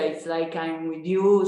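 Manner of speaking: usual speaking voice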